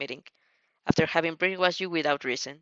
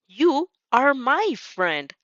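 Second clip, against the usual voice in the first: "You are my friend" slow, each word hit hard and higher; every word stressed; 6 semitones higher, 4 dB louder, much slower than usual. Acted. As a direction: press each word home, slowly. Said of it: "You emphasize every word".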